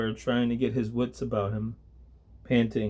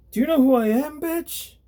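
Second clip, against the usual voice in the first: much higher, louder thug yelling a threat; angry thug; 5 dB louder than usual. Acted. Thug yells at the archangel, high and loud. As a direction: bark it up high like a cornered punk.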